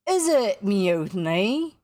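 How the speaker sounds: slow, loud, lilting question; menacingly pleasant. A narrator's reading. Hook questions the balking crew, ominously pleasant. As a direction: stretch it out in sweet threat. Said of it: "more pleasantly than ever".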